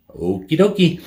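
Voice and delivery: high, singsong compliance; mock-agreeable okie dokie. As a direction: chirp it with put-on willingness. Mock-cheerful reply to customer.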